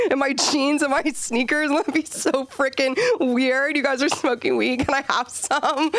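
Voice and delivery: put-on high, excited teen voice; giddy teen self. Teen at the bonfire to her friends, high giddy voice. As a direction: egg the crowd on like a reckless teenager. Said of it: "I was like".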